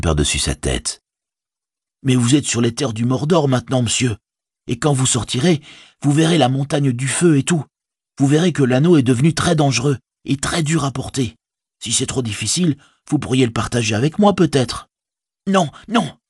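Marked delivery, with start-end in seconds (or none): 2.0-14.9 high-pitched, earnest hobbit servant; concerned Sam. A narrator's reading. Servant offering to share the burden, high and concerned. Sam warns Frodo in dialogue. 15.5-16.2 very high, alarmed protest; exclaimed refusal. A narrator's reading. Hobbit refusing to share the ring, high and vehement. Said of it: "s'exclama Frodo".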